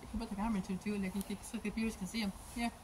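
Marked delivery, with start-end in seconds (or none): none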